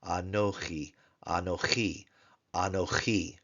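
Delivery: anochi with a guttural ch and the stress on the last syllable; Hebrew anochi. Shown as a demonstration. Teacher modeling anochi for Hebrew students, careful. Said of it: "followed by a kaf with a hirek yod".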